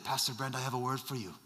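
low, quiet Nigerian voice; hushed, gentle attempt at a Nigerian accent; soft-spoken Nigerian accent. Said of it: "very soft-spoken Nigerian man".

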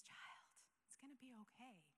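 soft, tender whisper; hushed fatherly reassurance; gentle whisper. Retold or imitated. God's gentle voice answers her.

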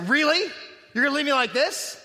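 retold scornful disbelief; disdainful. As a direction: imitate an affronted, disdainful complaint. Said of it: "all of the dis-, disdain that she could muster".

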